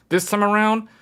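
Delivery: usual speaking voice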